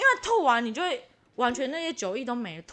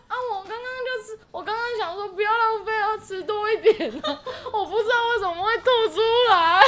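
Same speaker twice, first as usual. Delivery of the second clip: higher, laughing through the excuse; laughing mock excuse; 8 semitones higher than usual. Explaining to her husband why she threw up, laughing. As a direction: explain yourself while cracking up.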